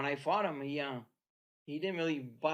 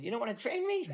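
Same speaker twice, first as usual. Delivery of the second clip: higher, squeaky, laughing through it; squeaky Arturo voice; 8 semitones higher than usual. Retold or imitated. Arturo teasing Mickey, squeaky voice breaking into laughter. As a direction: mimic him while cracking up. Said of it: "He got that squeaky Itali- uh, Italian, uh, French voice".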